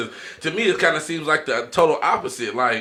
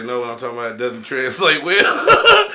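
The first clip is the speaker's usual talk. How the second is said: quoting Enrique; 2 semitones lower, 3 dB louder than usual. Retold or imitated. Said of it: "That's what Enrique say".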